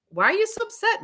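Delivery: put-on a puzzled boy asking his mother why she's upset; cheeky kid. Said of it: "imagine still twelve-year-old being like".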